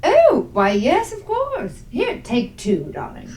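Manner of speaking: dote grandly on a little one; indulgent Agnes. Acted.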